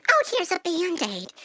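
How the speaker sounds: high put-on lady voice; imitating a lady. Beach lady offering help, high imitation. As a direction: mimic a helpful lady, pitched high. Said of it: "Then a lady's like".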